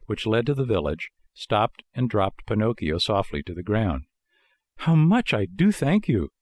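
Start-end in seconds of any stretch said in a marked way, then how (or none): 4.8-6.3 high, grateful puppet voice. A narrator's reading. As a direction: thank him, voice lifted high. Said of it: "said the marionette".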